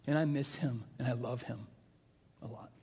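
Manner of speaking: soft, low, halting tribute; tender grief. Real, not acted. Pastor remembering a friend he misses, tender and subdued. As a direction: let it drop quiet and low, with pauses.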